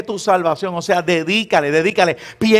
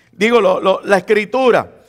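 usual speaking voice

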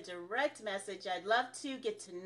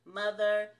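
ordinary talk, nothing marked

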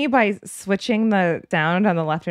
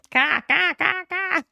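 high crow imitation, rising; mock crow cawing; 10 semitones higher than usual. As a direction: caw like a crow over a battlefield. Imitating a crow's call.

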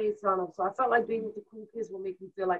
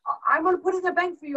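excited mom; 9 semitones higher, 4 dB louder, faster than usual. Retold or imitated.

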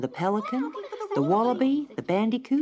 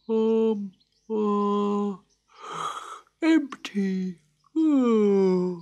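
slow, hesitant, drowsy voice; sleepy koala; 5 semitones higher, 3 dB louder, much slower than usual. A narrator's reading. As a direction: stammer it half asleep. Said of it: "said the koala".

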